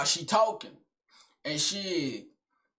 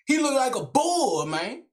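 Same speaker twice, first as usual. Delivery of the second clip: ordinary talk, nothing marked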